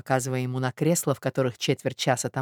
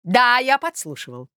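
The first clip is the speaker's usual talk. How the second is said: higher, self-goading indignation; rude defiance; 6 semitones higher, 3 dB louder than usual. A narrator's reading. Suitor admits eavesdropping, rudely defiant. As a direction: answer brazenly, working up your anger. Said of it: "грубо отвечал Мозгляков".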